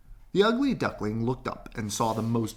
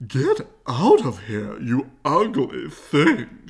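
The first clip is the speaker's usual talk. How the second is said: loud, slow, angry shout; furious mother bird; 4 semitones higher, 9 dB louder, slower than usual. Acted. Mother bird chasing the duckling away, loud furious shout. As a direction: chase him off with fury. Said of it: "she cried".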